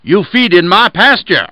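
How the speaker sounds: wolf hunting for a pretext against the lamb; accusing wolf. Acted. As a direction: press the accusation hard. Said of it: "Then said the wolf".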